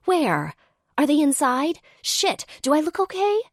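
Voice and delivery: high giddy rush; excited. A narrator's reading. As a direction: gush like a starstruck girl. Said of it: "looks around excitedly".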